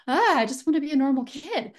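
high, loud whiny protest; exasperated kid. Retold or imitated. Little girl to the stranger praising her aura, exasperated and whiny. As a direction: groan it out like a fed-up little girl.

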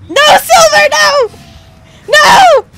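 high, loud cries of mock grief; melodramatic scream. Player watching Silver die, hammed-up anguished shout. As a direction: wail it like a tragic death scene.

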